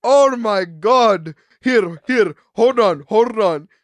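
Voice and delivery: loud, drawn-out exasperation; mock mad supervisor. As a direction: imitate someone at the end of his patience. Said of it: "that was the one that was getting mad at me".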